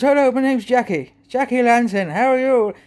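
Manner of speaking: high, lilting Irish character greeting; Irish accent. Acted. Irish guy called Jackie introducing himself, Irish accent. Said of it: "And he talked like that, like a, a, you know, Irish".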